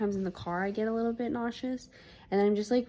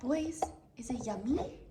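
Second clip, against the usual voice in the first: pet talk; 6 semitones higher, 6 dB quieter than usual. Real, not acted. Cooing at the pets eating.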